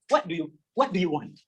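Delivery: ordinary talk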